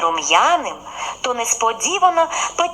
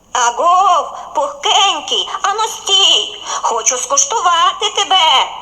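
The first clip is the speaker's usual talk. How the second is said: bleating goat; 6 semitones higher, 4 dB louder than usual. A narrator's reading. Goat calls the bun.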